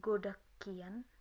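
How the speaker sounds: slow "Godak kian" with a hard k in the middle; natural reading. Shown as a demonstration. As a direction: model "Godak kian" slowly for learners. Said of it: "atau bisa dibaca".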